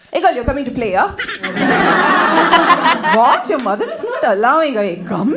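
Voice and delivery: hammed-up Tamil-accented English. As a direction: chat in a thick, playful Tamil accent. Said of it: "to pick up a Tamil accent".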